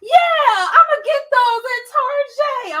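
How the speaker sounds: high, loud, crying mock reply; imitating tearful daughter. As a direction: mimic a grown woman answering like a weepy child. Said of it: "And she was like".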